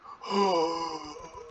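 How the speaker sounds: high rasping death gasp; imitated dragon death gasp; dying gasp. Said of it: "He's like".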